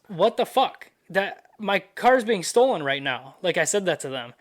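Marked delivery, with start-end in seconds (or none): 0.1-3.4 retold alarm, higher and louder; loud, high-pitched disbelief as if reliving it; alarmed outburst. Man tells bystanders his car's being stolen, alarmed outburst.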